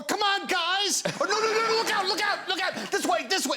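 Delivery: high, strained urgent calls; clenched Woody. Acted. Actor demonstrating a recording session, clenched and urgent. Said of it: "He's clenched".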